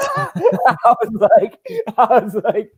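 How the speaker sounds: high laughing through the words; can't stop laughing as you tell it. Real, not acted. Boxer retelling a sparring brag to his friend, laughing.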